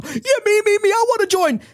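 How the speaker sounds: very high, eager voice; eager squeal. Acted. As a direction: pick me, pick me. Kim volunteering before the price.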